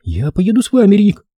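high-pitched female character; insistent woman. Acted. Лайза insists on coming.

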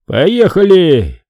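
high, drawn-out call; stretched-out shouted order; shouted command. A narrator's reading. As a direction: call the convoy to move, long and high. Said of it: "крикнул".

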